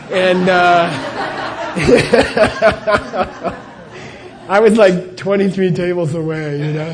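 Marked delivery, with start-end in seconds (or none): 0.9-4.4 breathy, unvoiced burst of laughter; helpless rolling laugh; big laugh. Real, not acted. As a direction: laugh out loud at your own punchline. Laughing at own story.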